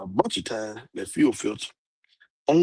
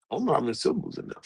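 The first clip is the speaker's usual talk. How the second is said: rapid, softer restatement; quick repeat; 5 dB quieter, much faster than usual. Real, not acted. Speaker repeating the syllable admission, quick and offhand. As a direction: rattle it off quickly and quietly.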